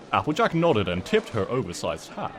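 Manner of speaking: usual speaking voice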